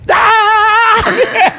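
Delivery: high, loud imitated scream; sudden scream. Cuffed woman in hospital bed yelling out, high and loud. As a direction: let out a sharp screech. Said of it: "she leans up and goes".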